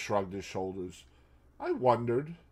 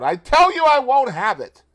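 John shouts, much higher and louder; shouting; 12 semitones higher, 13 dB louder than usual. A narrator's reading. Husband shouting at his wife in a quarrel, furious shout. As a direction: bellow it in a jealous fury. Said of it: "go shouting out".

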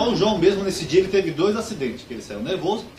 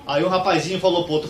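usual speaking voice

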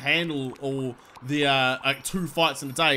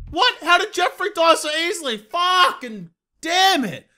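higher, louder, outraged cry that stretches out the curse; frustrated yell; 11 semitones higher, 6 dB louder than usual. Real, not acted. Gamer yelling at the screen after his gladiator dies, frustrated yell.

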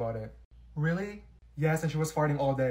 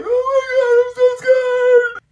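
high, slow, wavering fake fear; hammed-up fright on the coaster; mock scared squeal; 14 dB louder, slower than usual. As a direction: squeal it like you're terrified on the ride. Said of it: "I sat down and I was like".

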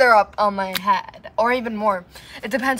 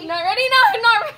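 scared protest; 10 semitones higher, 5 dB louder, much faster than usual. Real, not acted. Dreading ice water dumped on her.

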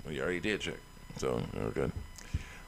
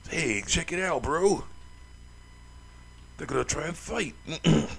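higher-pitched Aussie sidekick voice; put-on Australian accent; 7 semitones higher than usual. Sidekick telling bro about a fight, put-on Australian accent. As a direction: do a second, higher Australian character. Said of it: "I gotta get the accents right".

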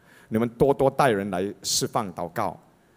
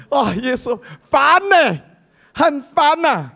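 exasperated; 12 semitones higher, 6 dB louder, slower than usual. Acted. Jesus exasperated by the noise.

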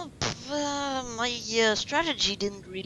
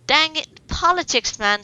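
loud, higher outburst; frustrated shout; 6 semitones higher, 9 dB louder than usual. Real, not acted. Yelling at the rival's politics card in frustration. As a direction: shout at the card that beat you.

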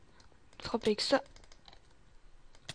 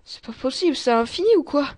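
raised, rising voice of exasperation; exasperated; 4 semitones higher, 10 dB louder than usual. Real, not acted. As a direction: burst out louder and higher, fed up. Exasperated at an endless passage. (exaspéré, en haussant le ton)

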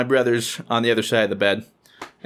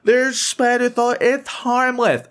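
high falsetto, dragged out and dismissive; imitating mom; 12 semitones higher, slower than usual. Mom who thinks he's crazy, high dismissive imitation. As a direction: brush it off in a mock-mother falsetto. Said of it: "my mom thinks I'm crazy, like".

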